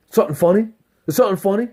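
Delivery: high-pitched challenge, rising at the end; imitated aggravation. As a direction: play a guy itching for a fight. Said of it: "he got a little aggravated".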